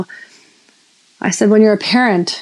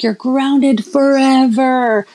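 slow, drawn-out mock decree, higher; mock stern verdict; 5 semitones higher, much slower than usual. Fed-up parent to screaming son in IKEA, slow mock-stern decree. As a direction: spell out the grounding slowly like a fed-up parent. Frustrated parent in store scene.

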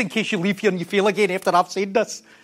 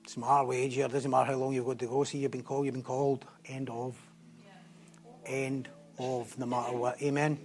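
low, much softer earnest appeal; soft closing exhortation; 11 semitones lower, 12 dB quieter than usual. Real, not acted. Preacher quietly urging the congregation to follow. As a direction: draw them in gently to close.

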